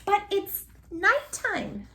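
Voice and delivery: high, slow squeaky little voice. A narrator's reading. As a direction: squeak it out like a small bat. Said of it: "squeaked Stella Luna".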